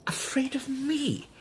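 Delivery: high, breathless whisper; barely able to voice it; whispered to himself in shock; stunned whisper. Acted. Dazed suspect repeats the accusation.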